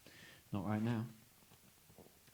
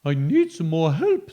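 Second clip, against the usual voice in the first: ghost asking for help; 3 semitones higher, 11 dB louder than usual. Acted. Ghost asking for help, ghostly plea. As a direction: plead like a ghost. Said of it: "said the ghost".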